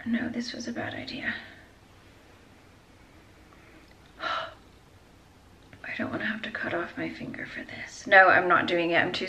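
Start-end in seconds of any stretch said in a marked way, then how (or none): 0.0-8.1 hushed, nervous whisper; really scared. Real, not acted. Afraid the ring will get stuck, hushed anxious whisper. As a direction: sigh and whisper your worry, very softly. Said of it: "Oh God, I'm really scared".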